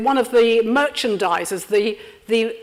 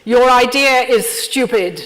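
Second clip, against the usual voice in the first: blunt put-down; 6 dB louder, slower than usual. Shown as a demonstration. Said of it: "blunt native translation, laughing".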